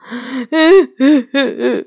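a high wailing sob. A narrator's reading. Faun sobbing before answering Lucy, wailing sob. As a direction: let out a long sobbing wail. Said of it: "托木纳斯呜咽道".

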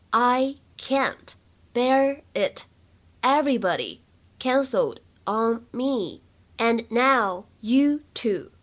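very slow, word by word reading; slow practice read. Shown as a demonstration. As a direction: spell it out slowly for learners. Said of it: "在这段台词朗读过程当中". (放慢速度逐词朗读)

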